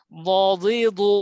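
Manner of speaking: ওয়াদিদু spaced slowly and loud; slow drill syllables. Shown as a demonstration. Teacher drills ওয়াদিদু for the class, slow and loud. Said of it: "drill with vowels".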